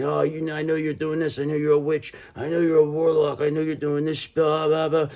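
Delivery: half-hearted imitation of an accusing person; mildly mocking people who call her a witch; mock accuser. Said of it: "They need you to start yelling, saying".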